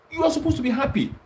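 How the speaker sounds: jumps way up high in pitch, sarcastic; mocking high taunt. Real, not acted. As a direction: taunt them in a high, sneering voice. Mocking panicking opponents.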